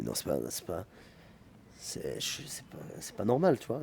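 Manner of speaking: low, hushed voice of a thought to himself; hushed inner thought. Retold or imitated. Telling a friend what he thought when offered the role, hushed inner voice. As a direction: murmur it as if only thinking. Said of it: "Je me suis dit".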